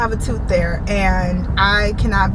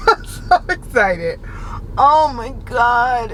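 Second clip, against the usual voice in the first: slow, giggly joy; laughing excitedly; 2 semitones higher, slower than usual. Real, not acted. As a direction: laugh through the words.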